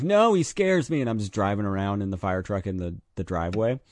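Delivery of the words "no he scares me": put-on high, scared little kid refusing. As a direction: whine it like a frightened child. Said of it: "And I'm like".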